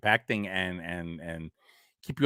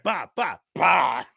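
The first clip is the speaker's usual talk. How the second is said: mock gun sounds; 6 semitones higher, 4 dB louder, slower than usual. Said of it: "she was like".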